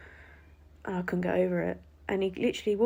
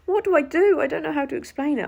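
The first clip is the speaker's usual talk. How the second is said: higher and louder, frantic and helpless; panicked; 8 semitones higher, 8 dB louder than usual. Retold or imitated. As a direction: blurt it out in a rush of panic. Panicking in the busy airport.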